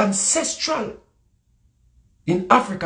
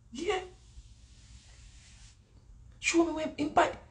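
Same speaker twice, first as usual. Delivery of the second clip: normal talking voice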